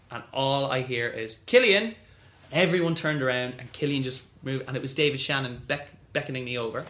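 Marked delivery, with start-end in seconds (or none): 1.5-1.9 name called loud and high across the room. Retold or imitated. Someone across the room calling him over, loud and high. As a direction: shout the name to get his attention.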